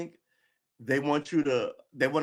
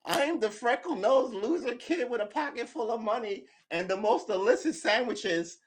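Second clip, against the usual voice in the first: mocking loser kid; 10 semitones higher than usual. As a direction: sneer it like the freckled kid who's about to be bullied. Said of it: "They might as well be going".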